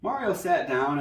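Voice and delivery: usual speaking voice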